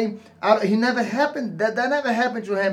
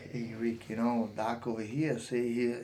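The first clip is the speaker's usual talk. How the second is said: much lower and softer, conspiratorial; sly instigating aside; 9 semitones lower, 10 dB quieter than usual. Pretend. Coach stirring up a fighter, low and sly. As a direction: murmur it like stirring up trouble. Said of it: "I'll be like".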